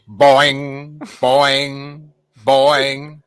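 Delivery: drawn-out "boing" sound effect, repeated; imitated boing. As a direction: voice a springy background boing, slowly. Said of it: "in the background, you just hear".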